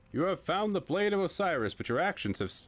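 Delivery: high, grand announcement; dramatic game voice. Acted. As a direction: proclaim it to the hero. Reads game dialogue.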